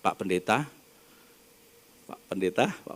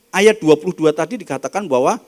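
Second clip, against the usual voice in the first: ordinary delivery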